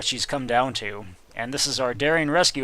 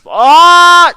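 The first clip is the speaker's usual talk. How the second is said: high piercing shriek; startled scream; 19 dB louder than usual. Acted. A shadow suddenly appears, high shriek. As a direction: shriek as if ambushed.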